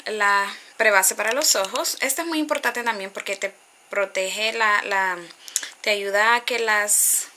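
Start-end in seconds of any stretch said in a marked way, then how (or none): none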